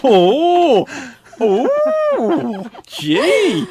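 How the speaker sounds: high, drawn-out mock-scandalised "oh"; teasing oohs. Host teases co-host who knows the frowner, high oohs. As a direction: stretch out a high teasing ooh.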